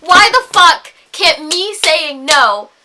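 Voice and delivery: slow, emphatic indignant questioning; exasperated demand. Real, not acted. Woman demanding that her no be enough, exasperated. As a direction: punch out each word in frustration.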